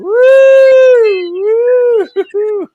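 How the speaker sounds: very high, loud party whoop; celebratory whoop. Real, not acted. Cheering two people celebrating, loud high whoop.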